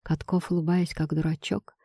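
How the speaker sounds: ordinary delivery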